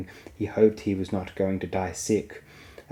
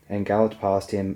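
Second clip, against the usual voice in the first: plain reading voice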